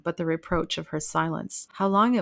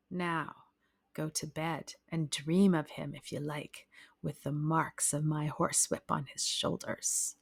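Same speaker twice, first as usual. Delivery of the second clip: softer, low menacing husband; cold threat; 3 semitones lower, 7 dB quieter than usual. A narrator's reading. Husband dismissing his wife to bed, quietly threatening.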